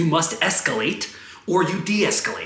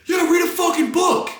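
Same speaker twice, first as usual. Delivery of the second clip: high, strained shout from a passerby; imitating an angry stranger yelling; heckler's yell; 13 semitones higher than usual. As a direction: holler it high at someone down the street. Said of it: "I hear somebody yell".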